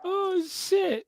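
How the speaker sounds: high startled oh shit; sudden high surprise; surprised exclaim. Real, not acted.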